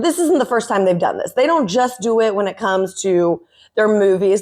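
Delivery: ordinary talk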